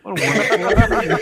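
rushed words breaking into laughter; laughing card reading. Real, not acted. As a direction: read it while cracking up.

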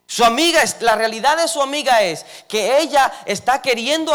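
high, loud preaching climax, building fervor; preaching at full force. Acted. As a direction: lift the congregation with a loud, high sermon push.

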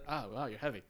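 low, soft offhand remark; casual, dropped-off comment; throwaway line. Retold or imitated. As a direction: toss it away under your breath. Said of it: "like a throwaway line".

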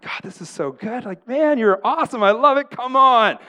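high, loud, worked-up praise; excited. Acted. Preacher acting himself thrilled while reading his Bible, excitedly shouting to God. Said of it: "I get excited".

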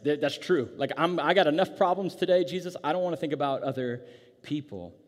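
high, loud acted complaint; mock-exasperated refusal; overwhelmed protest. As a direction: push back as someone with too much already. Acts overwhelmed listener answering Jesus.

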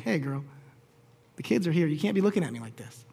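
hushed and low, telling his wife off quietly. Retold or imitated. Husband hushing his wife at the dinner table, low and hushed. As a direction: keep it down so the kids don't hear.

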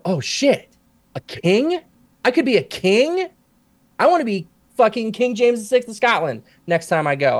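put-on high-pitched, amazed kid voice; retold childhood excitement. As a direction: act out a child thrilled by a discovery. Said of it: "And so I go".

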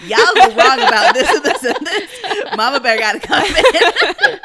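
loud, high, drawn-out playful telling-off; mock scolding. Teasing Evie as Mama Bear correcting everyone, mock scolding. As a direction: set them straight in hammed-up outrage.

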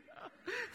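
a quick amused laugh at himself; short laugh. Real, not acted. Preacher laughing at his own slow English, short laugh. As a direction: break into a brief laugh. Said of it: "laugh after own remark".

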